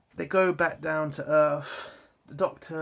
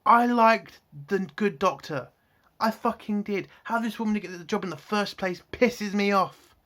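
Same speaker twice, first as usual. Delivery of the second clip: raised, higher-pitched angry outburst; pissed-off rant; 4 semitones higher than usual. Real, not acted. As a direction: let real anger punch the key words. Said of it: "I'm pissed off right now".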